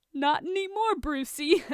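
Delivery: put-on drunk laughing.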